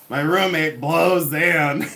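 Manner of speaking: slow, drawn-out comic line; a light stand-up impression; imitating Judy Tenuta. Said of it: "she would say".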